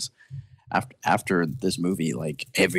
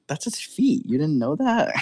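put-on teasing kid voice; 10 semitones higher than usual. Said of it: "And he's like".

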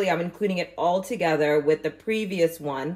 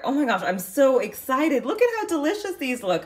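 excited; 7 semitones higher than usual. Real, not acted. Delighted at big harvest.

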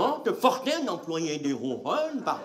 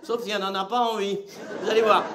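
usual speaking voice

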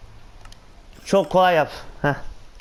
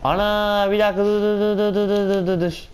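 panicked stop; 4 semitones higher than usual. Real, not acted. Panic as thunder and mobs come.

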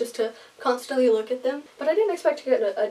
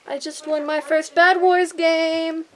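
excited; 5 semitones higher, 5 dB louder, slower than usual. Real, not acted. Gamer win announcement.

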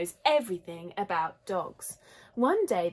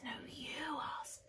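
very soft, higher whisper; whispered retort; 7 semitones higher, 13 dB quieter than usual. A narrator's reading. Lotta hands the asking back to Lola, whispering. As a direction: whisper it straight back, passing the job on. Girls nudge each other secretly.

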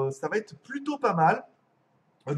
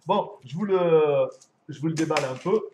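usual speaking voice

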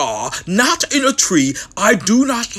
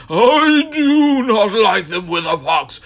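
plain reading voice

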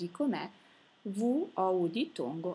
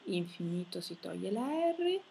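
ordinary delivery

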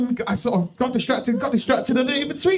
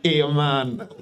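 low, comic attempt at Italian; bad Italian accent; 8 semitones lower than usual. Comedian demonstrates her Italian, low comic accent. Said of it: "That's my Italian".

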